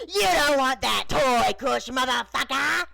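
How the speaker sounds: near-shriek, very high and loud; put-on rapper voice shrieking, drawn out. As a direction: shriek it in character, ignored and aggressive. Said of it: "the doll practically shrieked".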